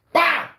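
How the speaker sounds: very high, loud "Bah!" for the chair hit; smack noise. Retold or imitated. Wolf hits a man with a chair, loud mock smack. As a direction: shout out the impact.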